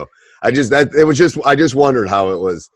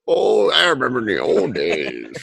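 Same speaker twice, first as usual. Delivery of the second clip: put-on old man voice; 5 semitones higher, slower than usual. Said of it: "doing the podcast when I'm ninety-two".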